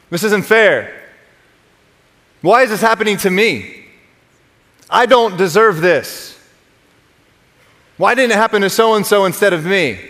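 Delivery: lightly acted, aggrieved complaint; mock complaining. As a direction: complain as if mildly wronged, line by line. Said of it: "complaining sounds like this".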